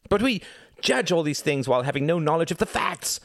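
high, heated outburst; impassioned cry. A narrator's reading. Reginald thumping his fist in argument, crying out. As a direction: cry out in heated protest, pitch soaring. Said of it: "cried Reginald".

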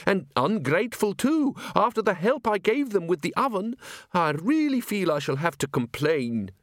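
prim, stammering museum curator; fussy curator voice. Acted. Curator complaining.

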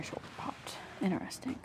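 whispered aside. Real, not acted. Vlogger whispering after her shoulder pops. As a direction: breathe it as an aside.

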